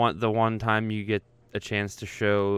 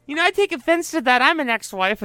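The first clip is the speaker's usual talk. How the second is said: much higher, louder mock offended woman; mocking the supervisor; 5 dB louder than usual. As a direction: parody her prim retort. Mocks the supervisor's retort.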